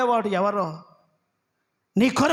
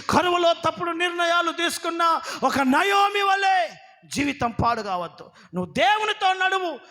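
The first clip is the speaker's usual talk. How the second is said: fervent preaching; 6 semitones higher than usual. Acted. Pastor exhorting the congregation about famine. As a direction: preach it out to the church.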